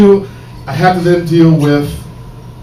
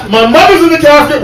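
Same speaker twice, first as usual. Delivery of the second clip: ordinary talk, nothing marked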